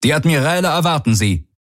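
high, quick crew report; fast, high-pitched crew announcement; crew member reporting. A narrator's reading. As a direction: deliver a brisk message to your commander. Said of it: "meldete ein Crewmitglied".

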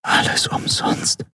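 slow, resigned whisper. A narrator's reading. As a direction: whisper it slowly, all hope gone. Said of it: "flüsterte sie mit geschlossenen Augen". (langsames, resigniertes Flüstern)

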